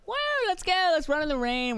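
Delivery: put-on very high whooping kid-like cheer; mock high-pitched excited whoop; carefree glee. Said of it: "kinda be carefree with 'em".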